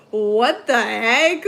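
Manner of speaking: loud, slow mock what the heck; hammed-up indignant disbelief. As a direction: drag it out in fake shock. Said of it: "It's like".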